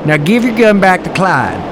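put-on thick drawled cowboy accent; put-on thick country accent. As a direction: lay on a thick rural Western accent. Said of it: "Your accent's very thick".

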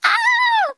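sharp high scream, very loud; retold scream. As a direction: reenact the sister's sudden scream in her face. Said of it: "screamed at me in my face".

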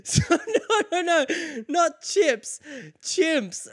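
very high squeal through laughter; laughing mock panic. He retells correcting the crowd, giggly high-pitched mock panic.

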